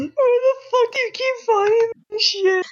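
very high squeal through laughter; helpless laughter. Real, not acted. Friend asking where the videos come from, laughing helplessly. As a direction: wheeze it out, nearly crying with laughter.

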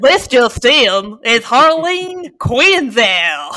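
loud, drawn-out mocking nerd voice; hammed-up know-it-all shout. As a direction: exaggerate a pedantic correction, slow and loud. Mock nerd voice correcting the name.

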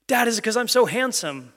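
put-on child squirrel begging for praise; eager kid squirrel. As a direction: pipe up like an eager little kid. Kid squirrel voiced in story.

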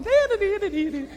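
silly singsong, careless and light; carefree sing-song. Retold or imitated. Preacher mocks a grinning Jesus, silly carefree singsong. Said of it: "you think he was going".

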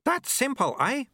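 very high, chirpy character voice; eager apprentice. A narrator's reading.

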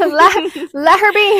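high, very loud protest; acted indignant outburst. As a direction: loudly stand up for your friend. Acted defence of a friend, outburst.

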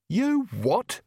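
high, rising, disbelieving question; startled double-take; incredulous. Acted. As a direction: leap up in pitch, appalled. Nanny reacts in disbelief.

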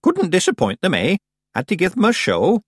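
put-on high-pitched, self-satisfied old woman; proud old witch. A narrator's reading. Miss Treason justifying her show to Tiffany, proud. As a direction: crow about the performance. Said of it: "said Miss Treason proudly".

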